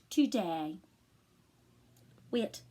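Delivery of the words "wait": normal talking voice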